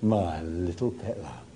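hushed loving endearment; tender Jesus. Acted. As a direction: say it as Jesus would to a child. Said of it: "imagine Jesus saying to you".

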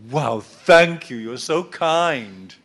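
slow, dry mock thanks; sarcastic God. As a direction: drag it out, deadpan sarcasm. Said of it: "I can hear God saying".

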